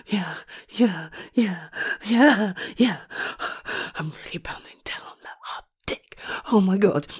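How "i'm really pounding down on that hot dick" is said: whispered moan; breathy whisper. Acted. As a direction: gasp it out under your breath. Moaning during act.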